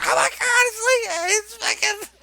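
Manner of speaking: very high, loud fan screaming; freaking out. Retold or imitated. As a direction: re-enact a star-struck freak-out at full volume. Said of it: "I flipped out".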